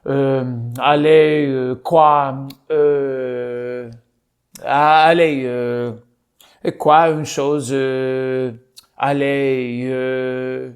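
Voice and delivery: nothing but drawn-out French fillers; pretend French. Faking Frenchness with only fillers, hesitant French accent. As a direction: stall in French, pausing between fillers. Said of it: "where I pretend to be French".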